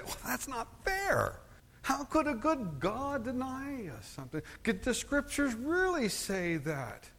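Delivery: high, incredulous objector voice; voicing an objector. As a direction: mimic a doubter's protest. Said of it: "Some might say".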